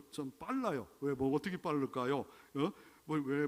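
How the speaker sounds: usual speaking voice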